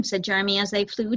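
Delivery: usual speaking voice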